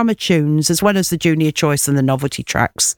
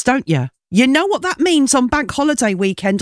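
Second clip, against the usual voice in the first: normal talking voice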